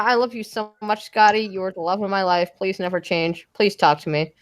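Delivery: dry sarcastic love declaration. Pretend. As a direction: say it with a flat, mocking tone. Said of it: "sound so sarcastic when you said that".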